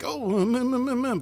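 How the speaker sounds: high, loud panicked hums; a hint of panic in the humming; mock freak-out. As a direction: play it as sudden alarm. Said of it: "Michael freak out".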